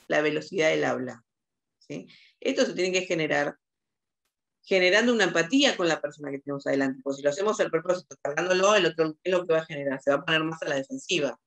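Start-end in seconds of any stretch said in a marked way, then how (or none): none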